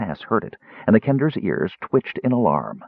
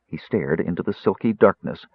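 ordinary delivery

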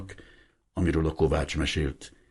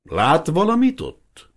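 a loud, much higher, slow yell; shouted into his ear; 11 semitones higher, 7 dB louder, slower than usual. Acted. Old Hiribi yelling the question into the priest's ear over the waterfall. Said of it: "úgy ordította a fülébe a kérdést".

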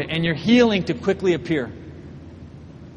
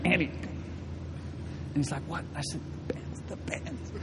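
very soft, voiceless whisper; furtive whisper; 3 semitones lower, 14 dB quieter, slower than usual. Acted. Preacher secretly alerting Andy to the pens, whisper. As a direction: whisper it so the doctor won't hear.

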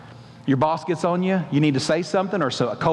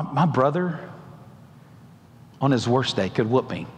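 frightened prayer; 7 semitones lower than usual. Acted. Jacob's frightened prayer voiced.

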